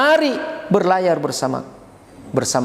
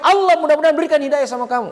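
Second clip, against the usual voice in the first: higher and fast heated blessing; mock angry curse; 9 semitones higher, much faster than usual. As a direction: rattle it off in a temper. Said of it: "Weh, marah itu". (doa bernada marah, cepat dan tinggi)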